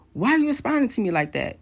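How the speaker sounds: retold phone outburst, indignant and high-pitched; mock an angry husband's rapid complaint; imitating angry yelling. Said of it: "he's yelling".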